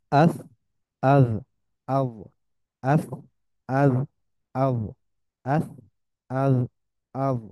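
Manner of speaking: আস, আজ, আজ each ending on a distinct Arabic letter; practising three Arabic letter endings; letter drill. Shown as a demonstration. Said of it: "এই তিনটার উচ্চারণটা হচ্ছে".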